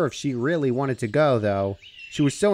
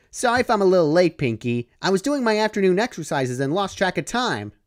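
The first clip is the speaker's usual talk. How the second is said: Dash's quick, raised sorry; apologizing; 6 semitones higher than usual. Acted. Dash apologizes to Pinkie for being late, quick and raised. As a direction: rush the apology out a little higher. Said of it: "Dash apologized".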